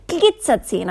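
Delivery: enthusiastic exclamation. Acted. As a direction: cheer the word with glee. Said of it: "rief Mister Quatschkopf begeistert".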